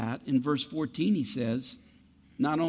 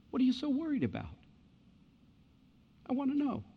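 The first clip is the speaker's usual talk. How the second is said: soft, quiet and caring; Jesus softly asking to spend time together; tender Jesus voice; 10 dB quieter than usual. Acted. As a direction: speak softly, like a friend who truly wants to listen.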